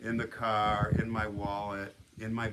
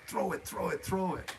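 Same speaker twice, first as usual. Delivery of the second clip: higher-pitched, insistent repeated plea; urgent urging; 6 semitones higher than usual. Retold or imitated. Telling the kid at the wall to throw it, urgent. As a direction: egg someone on in a hurry.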